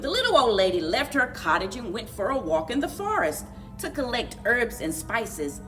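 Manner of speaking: plain reading voice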